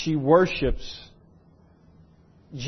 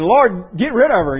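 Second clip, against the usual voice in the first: louder, higher, irritated demand; tired of her; 4 semitones higher, 7 dB louder than usual. Retold or imitated. Disciples begging Jesus to get rid of the woman, irritated. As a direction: sound fed up with her. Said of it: "they just got tired of hearing her".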